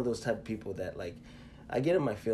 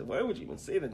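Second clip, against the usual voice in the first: high-pitched wounded protest at usual volume; hurt protest; 8 semitones higher, faster than usual. Retold or imitated. Friend retelling his reply to being called weak, hurt protest. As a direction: plead in a higher voice, wounded. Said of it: "do that in your Kevin Hart voice".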